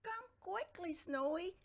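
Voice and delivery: high urgent call for a friend; Stanley calls his friend upstairs, high and urgent. A narrator's reading. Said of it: "called Stanley".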